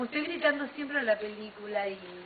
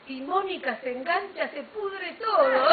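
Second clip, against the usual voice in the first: higher, louder, laughing through the words; laughing excited quote; 8 semitones higher, 10 dB louder than usual. Retold or imitated. Friends joking about the fight, laughing and excited. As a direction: blurt it out while cracking up. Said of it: "nos dijimos".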